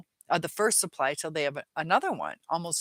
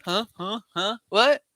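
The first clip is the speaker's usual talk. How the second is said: mock confused kid; 4 semitones higher, 3 dB louder than usual. Imitating confused kids.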